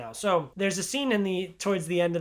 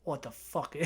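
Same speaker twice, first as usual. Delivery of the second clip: hushed, mock-stunned muttering; soft imitation of a baffled reaction; stunned disbelief; 3 semitones lower, 10 dB quieter than usual. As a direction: drop right down, acting dumbfounded. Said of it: "he just has this face of".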